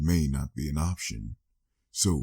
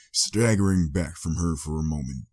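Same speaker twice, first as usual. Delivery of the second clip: usual speaking voice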